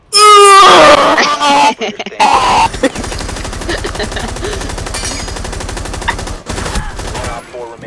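loud, high-pitched screeching laugh; piercing screech breaking into cackles; throat-straining screech. Real, not acted. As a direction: shriek till it hurts the throat. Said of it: "that hurt my throat making that noise".

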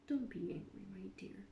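soft whispered soothing; quiet whisper. A narrator's reading. King soothes the angry Queen, whispering. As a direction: whisper to calm her. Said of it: "He said quietly".

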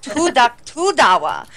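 Miss Swan. Retold or imitated. Quoting a favorite sketch character, mock accented price list. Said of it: "Miss Swan from Mad T V when she's like".

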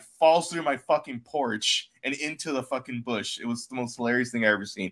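ordinary talk

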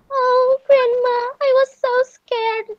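mock-sobbing child's voice. A narrator's reading.

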